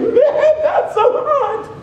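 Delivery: very high, slow laughing delight; God chuckles at your plenty. Acted. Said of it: "And God is saying".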